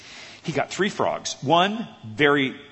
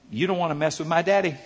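normal talking voice

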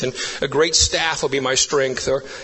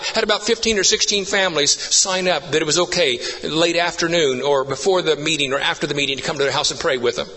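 usual speaking voice

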